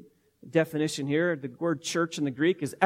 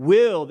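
"will" punched out loud on its own; stress on will; 8 dB louder than usual. Shown as a demonstration. Preacher unpacking each word, "will" hit loud. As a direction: hit "will" hard and let it fall. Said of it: "We could look at each one of those words".

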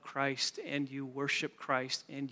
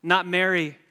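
loud, driving preacher's voice, climbing higher near the end; preaching at full force; 4 semitones higher, 9 dB louder than usual. Acted. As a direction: preach it to the back row, building. Preacher drives the point home.

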